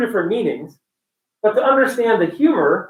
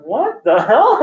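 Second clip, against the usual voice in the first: baffled question through laughter; laughing bafflement; 3 semitones higher, slower than usual. Retold or imitated. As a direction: laugh while asking in disbelief. Acts out his baffled reaction, laughing.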